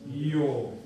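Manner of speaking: "Yo" with the tongue near the palate, loud; demo of jo. Shown as a demonstration. Teacher demonstrating the plain j sound to the student. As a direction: say "Yo" with the tongue almost touching the palate. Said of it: "Pero no toca".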